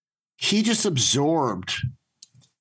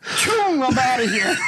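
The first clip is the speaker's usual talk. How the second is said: put-on startled kid bolting; 9 semitones higher, 3 dB louder than usual. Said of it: "he just went".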